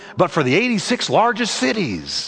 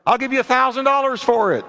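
high, quick, eager bid; eager offer; 7 semitones higher, faster than usual. A narrator's reading. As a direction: jump in fast with an offer, pitched up. Stranger makes an eager offer.

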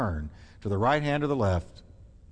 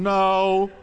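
convicted no; 5 semitones higher, 5 dB louder than usual. Acted. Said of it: "You can see him under conviction".

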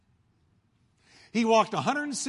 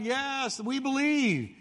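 shouting crowd; 8 semitones higher, much slower than usual. Retold or imitated. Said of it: "They shouted".

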